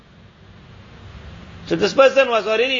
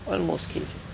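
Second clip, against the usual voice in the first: much softer, murmured repeat to himself; quiet echo; 2 semitones lower, 11 dB quieter, faster than usual. Retold or imitated. King, shaken, repeating to his friend, in a hushed echo. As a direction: let it fall away, half to himself.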